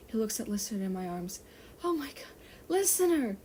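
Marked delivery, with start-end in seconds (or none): 1.8-3.4 high gasping alarm at the sight; shocked cry. Acted. Armin seeing Listener bleeding, shocked cry.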